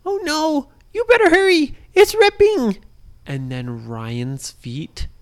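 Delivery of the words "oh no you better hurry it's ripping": high, urgent little bunny; a tiny rabbit urging them to hurry; squeaky bunny voice. Acted. Bunny warns kids the paper is ripping, high and urgent.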